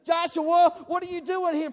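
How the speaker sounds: high, loud mock scolding; hammed-up disbelief at the kid; mock exasperation. Scolding prank volunteer.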